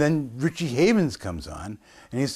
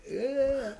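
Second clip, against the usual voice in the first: awed; 14 semitones higher, 6 dB quieter than usual. Retold or imitated. Campers' jaws dropping.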